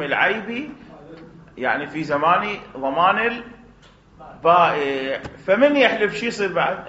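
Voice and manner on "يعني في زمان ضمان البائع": usual speaking voice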